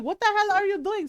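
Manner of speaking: mom's outrage. Retold or imitated. Mom catching the kids, mock outraged. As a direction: imitate an appalled mother.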